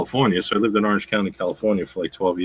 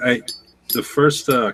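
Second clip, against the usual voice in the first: ordinary talk, nothing marked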